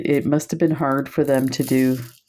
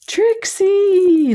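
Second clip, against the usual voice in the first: high, loud calling out a dog's name; sing-song call; 4 dB louder than usual. Retold or imitated. Neighbour calls the dog by a made-up name, high sing-song call. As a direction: call across the street to the dog, high and ringing. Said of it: "like this".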